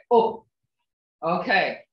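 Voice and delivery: ordinary talk, nothing marked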